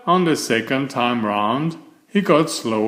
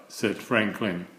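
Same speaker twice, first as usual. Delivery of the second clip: plain reading voice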